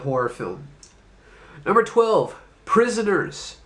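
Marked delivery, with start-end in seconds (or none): none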